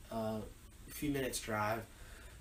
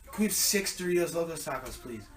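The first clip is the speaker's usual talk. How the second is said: quick nervous order; 4 semitones higher, 5 dB louder than usual. Retold or imitated. Ordering at drive-thru.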